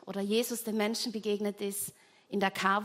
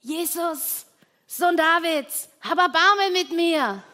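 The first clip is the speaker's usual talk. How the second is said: high, loud desperate cry; screaming for mercy; 8 semitones higher, 10 dB louder than usual. Acted. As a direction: cry out to Jesus over the crowd. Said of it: "Bartimäus beginnt zu schreien".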